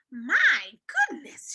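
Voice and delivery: slow, loud tut of dismay. A narrator's reading. Old woman seeing the room, slow dismay. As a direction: shake your head at it. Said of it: "with a shake of her head".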